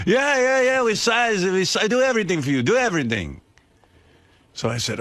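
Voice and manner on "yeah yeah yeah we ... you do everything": hammed-up Italian salesman promising everything; Italian accent. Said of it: "he had some foreign accent".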